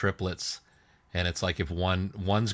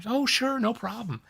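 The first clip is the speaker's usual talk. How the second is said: high bright friendly attendant; cheerful stewardess voice. Retold or imitated. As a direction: chirp it like a helpful stewardess.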